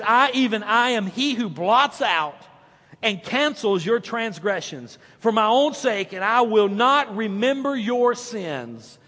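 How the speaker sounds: slow, deliberate reading of the verse; scripture reading. Acted. Pastor reading Isaiah to the church, slow. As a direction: read it weighty and measured.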